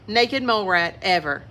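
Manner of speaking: ordinary delivery, nothing marked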